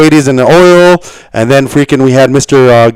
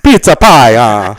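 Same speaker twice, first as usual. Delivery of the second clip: jolly italian pizza chef; 2 semitones higher than usual. Pretend. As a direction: play a cheery pizza man. Joking Italian stereotypes.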